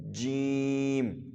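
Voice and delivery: جیم stretched very slowly; drawn-out jeem. Shown as a demonstration. Teacher showing a stretched جیم. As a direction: pull جیم out long. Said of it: "تھوڑا سا لمبا کر سکتے ہیں".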